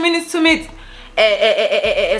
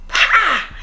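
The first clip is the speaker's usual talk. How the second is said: sharp, much higher "pa" like a slap; imitated slap sound; 11 semitones higher, 3 dB louder than usual. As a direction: mimic a loud smack with your mouth. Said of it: "like a smack".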